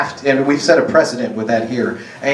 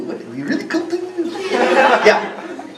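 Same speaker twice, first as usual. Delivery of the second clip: sing-song tease; 12 semitones higher than usual. Acted. Playful teasing to audience.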